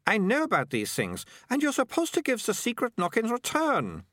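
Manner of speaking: put-on high, indignant know-it-all girl; irritated, insistent, pitched well up; snapped. A narrator's reading. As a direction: lecture crossly, voice climbing high. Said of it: "Melicia snapped".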